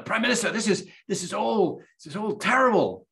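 high, frantic panicked aide voice; panicked rush. Retold or imitated. Staffer bursting in on the prime minister, high and panicked. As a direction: burst in crying that it's all going wrong. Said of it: "someone else rushes in".

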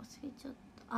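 soft whispered aside. Real, not acted. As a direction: whisper it to yourself.

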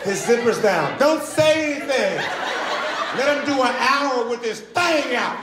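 high-pitched mock plotting among the crowd; exaggerated high voice of a scheming onlooker; mock audience member. Acts out the audience whispering conspiracy.

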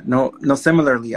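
ordinary talk

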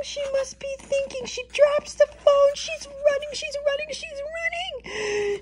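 very high, excited narration; breathless urgency. A narrator's reading. Mama llama runs to her baby, urgent. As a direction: race along in mock alarm. Narrating mama's panicked dash.

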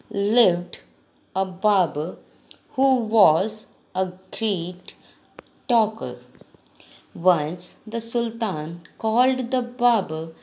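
slow, careful reading to pupils; measured classroom story narration; story read-aloud. A narrator's reading. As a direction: read it out slowly, phrase by phrase.

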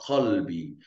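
Qalbi slowly, the Q deep in the throat; back-of-the-throat Q starting Qalbi; throaty Arabic Q. Shown as a demonstration. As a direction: stretch Qalbi from a guttural Q. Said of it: "Vai ficar Qalbi".

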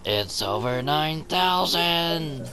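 slow, high, drawn-out meme quote; anime meme shout. Pretend. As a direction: stretch it like the famous anime scream. Quoting famous anime meme.

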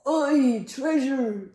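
hammed-up pirate cheer; crew shouting. A narrator's reading. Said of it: "the others shouted".

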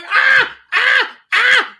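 put-on high yelped ahs of a boy being beaten; hammed-up high cries of pain; mock pain cries. Screaming while being whacked.

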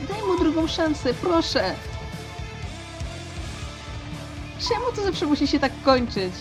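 high-pitched plea falling away; helpless, upset appeal; desperate pleading. Acted. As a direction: beg for someone to be spared. Pleading for a second chance.

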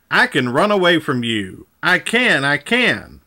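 plain reading voice

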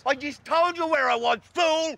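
high, angry yell of exasperation; real anger, pitched right up; furious shouting. Real, not acted. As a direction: shout the reporter down. Losing temper at the reporter.

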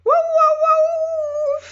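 very high, loud yelping howl; howling dog. A narrator's reading. Dog sliding downhill on the sled, howling very high and loud. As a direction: yelp in fright and glee down the slope. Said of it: "hurla Bernard".

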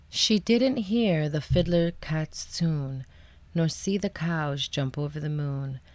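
gentle narration trailing into a hush; hushed bedtime narration. A narrator's reading. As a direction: read softly, winding down to sleep. Hushed bedtime ending of story.

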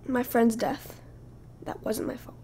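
ordinary talk

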